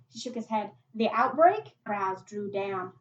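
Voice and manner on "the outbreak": loud, rising disbelief; incredulous question. Acted. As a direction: ask it loudly as it dawns on you. Boy realises what she means.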